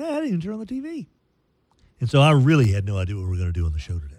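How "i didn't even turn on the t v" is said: higher, softer and fast, a put-on voice; squeaky mock self-quote. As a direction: toss it off high and quick like a little aside. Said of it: "I was like".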